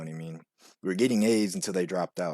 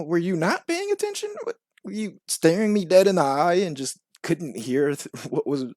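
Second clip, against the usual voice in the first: mock disbelief in a raised pitch; incredulous questioning; 9 semitones higher, 4 dB louder than usual. Teacher to studious kids who failed the test, incredulous. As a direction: question them high, baffled by the failure.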